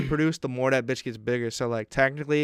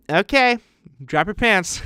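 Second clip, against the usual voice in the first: higher, louder nurse voice; imitated nurse; 8 semitones higher, 8 dB louder than usual. Nurse telling patient to undress, imitated higher voice. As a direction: play the nurse giving a brisk order.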